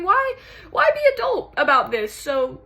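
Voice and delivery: high, loud, stammered frustration; worked-up, wounded protest; exasperated challenge. Acted. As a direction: demand an answer, fed up. Challenging lover over being adult.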